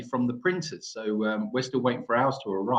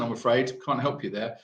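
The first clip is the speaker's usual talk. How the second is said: ordinary talk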